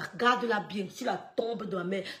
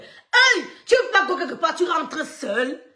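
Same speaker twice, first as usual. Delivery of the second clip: high, loud scolding warning; big brother shouting a warning down the line; 4 semitones higher, 7 dB louder than usual. Retold or imitated. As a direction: call him out, then slow right down on tu rentres seul. Warning the cousin loudly.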